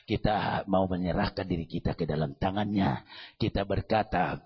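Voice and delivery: loud, fervent call to stand; loud altar call. Acted. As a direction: lift the whole room to its feet. Calls congregation to stand, pray. (ajakan berdoa yang lantang)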